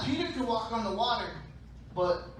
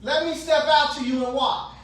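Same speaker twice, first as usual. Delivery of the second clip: loud, high holler across distance; calling out; 8 semitones higher, 9 dB louder than usual. Acted. Peter calling to Jesus from the boat, hollering. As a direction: shout to someone far off over water.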